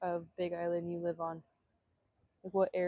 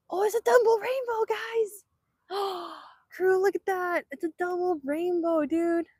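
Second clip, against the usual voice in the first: high, thrilled exclaiming; squealing with delight at a double rainbow; excited; 8 semitones higher than usual. Real, not acted. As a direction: gush over the double rainbow.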